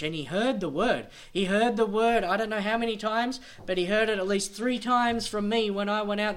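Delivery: loud, high, driving sermon voice; full-force preaching. Acted. Preacher warning churchgoers they will be accountable, fiery preaching. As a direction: hammer the warning home to the church.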